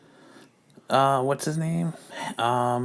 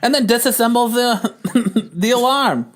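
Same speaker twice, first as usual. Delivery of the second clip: put-on high-pitched old-time narrator reading the scene; old-timey narration; 8 semitones higher than usual. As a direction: keep the raised narrator voice going through the action. Narrates the comic in a voice.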